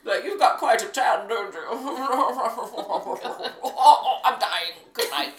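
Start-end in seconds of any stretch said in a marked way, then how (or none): 0.2-1.6 put-on high, loud caricature of an old royal; hammed-up doddery aristocrat; mock old British royal. Playing Prince Philip greeting the fiancée, high mock-British voice. 1.7-5.3 loud, hammed-up death throes; mock dying royal. Playing Prince Philip keeling over, loud and hammed up.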